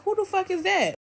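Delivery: high-pitched mock confrontation; imitated indignant question; retold angry demand. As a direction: demand it as the irritated boyfriend. Boyfriend challenges the arriving friend.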